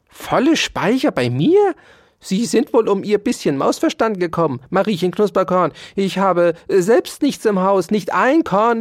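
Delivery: loud, high, outraged tirade; furious shouting. A narrator's reading. Dauerspeck denies having full stores, shouting furiously. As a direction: rant indignantly with pitch pushed up. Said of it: "schrie Ambrosius Dauerspeck wütend".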